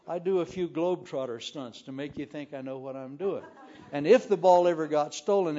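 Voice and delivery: ordinary talk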